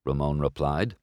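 plain reading voice

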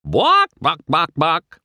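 high, loud clucking taunt; squawky mocking chicken noises; chicken imitation. A narrator's reading. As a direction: cluck like a hen to mock a coward. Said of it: "started imitating a chicken".

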